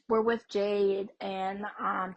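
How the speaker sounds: normal talking voice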